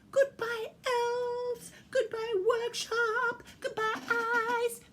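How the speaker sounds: very high, thrilled squeaky reindeer; excited Ruby. Acted. Ruby sets off on Santa's sleigh, excited high voice. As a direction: squeal each line, stretching the ends.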